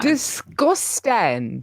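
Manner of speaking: high, very drawn-out condemnation; emphatic disgust. Real, not acted. Condemning adult baby talk, hammed-up disgust. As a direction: stretch each syllable in disgust.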